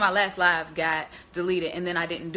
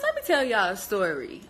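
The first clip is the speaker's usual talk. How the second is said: ordinary talk, nothing marked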